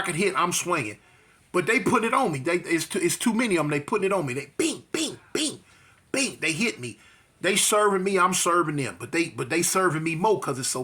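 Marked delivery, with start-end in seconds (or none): 4.6-6.4 punch noises. Retold or imitated. Blows imitated.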